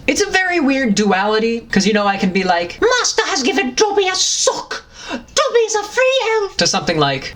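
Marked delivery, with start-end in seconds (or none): 2.8-6.6 squeaky high house-elf voice, much higher; high squeaky elf, excited; Dobby voice. Acted. Dobby the house elf rejoicing over his sock. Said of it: "I'm also Dobby the house elf".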